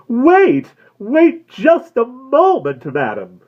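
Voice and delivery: high, loud, slow calling out; shrill excited. A narrator's reading. Florist calling the woman back, high and loud. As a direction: call her back eagerly. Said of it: "in a shrill, excited voice".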